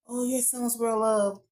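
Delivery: slow, drawn-out phony sympathy; fake condolence. Mourners to the dead man's dad, fake sympathy. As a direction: imitate insincere funeral kindness, stretching it out. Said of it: "everybody being fake as hell".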